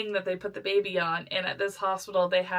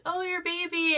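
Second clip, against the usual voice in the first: high cooing; 10 semitones higher than usual. Retold or imitated. Nurses cooing about baby.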